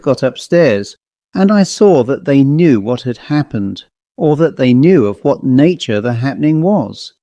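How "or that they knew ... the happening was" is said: ordinary delivery, nothing marked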